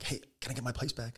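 lightly voiced kid asking back. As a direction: whisper it like a small boy. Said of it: "He was like".

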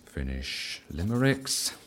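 murmured note-taking. Acted. Writing down the other man's wish, quiet slow murmur. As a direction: mutter it to yourself while jotting it down. Repeats the item while writing it down.

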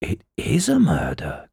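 unvoiced, breath-caught whisper; shocked gasp. A narrator's reading. Salesman hearing of a murder, gasped whisper. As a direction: gasp it out, stunned. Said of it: "Quaid gasped".

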